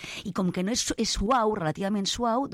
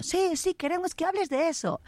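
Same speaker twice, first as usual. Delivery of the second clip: much higher eager crowd voice; squeaky mock fans demanding more; imagined audience cheering; 11 semitones higher than usual. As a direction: voice the clamoring listeners. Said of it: "mucha gente estará aplaudiendo".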